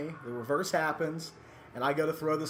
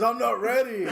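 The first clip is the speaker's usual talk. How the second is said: laughing, higher and louder mock fear; hammed-up terror of going out; mock panic; 9 semitones higher, 6 dB louder than usual. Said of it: "He goes".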